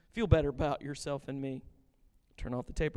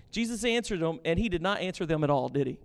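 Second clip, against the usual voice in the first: ordinary delivery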